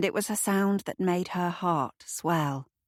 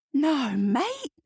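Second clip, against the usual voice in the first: higher, appalled protest; horrified; 9 semitones higher than usual. A narrator's reading. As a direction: recoil at the idea, voice up high. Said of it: "looking horrified".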